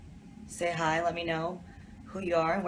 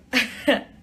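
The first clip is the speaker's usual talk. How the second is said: laugh; 7 semitones higher, 8 dB louder than usual. Real, not acted. Laughing at fan's tweet.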